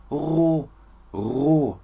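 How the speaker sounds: RO with a breathy, unvoiced throat R; ro, R without any buzz; voiceless R. Shown as a demonstration. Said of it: "Und das hier oben".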